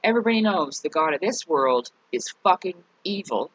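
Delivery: ordinary talk, nothing marked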